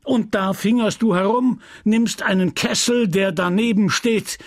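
the pharmacist fuming at his apprentice; a lightly voiced furious old apothecary; angry apothecary. Homais interrogating Justin.

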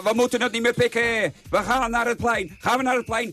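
put-on Rotterdam accent. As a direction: rouse the crowd in broad Rotterdam accent. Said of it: "op cursus plat Rotterdams ging".

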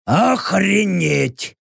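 slow, gurgled alien exclamation; gurgling tentacle alien. Acted. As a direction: gurgle the word out like a creature. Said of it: "пробулькал Рек".

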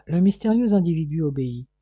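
ordinary delivery

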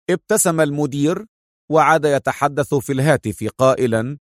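usual speaking voice

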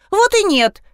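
high-pitched, indignant denial. A narrator's reading. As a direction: deny it like an offended child. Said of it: "возмутилась та". (возмущённо)

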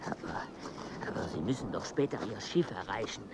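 a whispered nudge to hurry for the ship; urgent whisper. Acted. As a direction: whisper it so no one overhears. Urging in a hushed voice.

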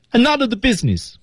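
high-pitched theatrical protest; desperate complaint. Acted. Acting the final turn after kneeling, high desperate complaint. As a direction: turn and lament it to everyone, pitch way up. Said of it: "表达的是一种要抱怨".

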